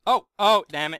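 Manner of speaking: sudden loud oh no; frustrated outburst. Real, not acted.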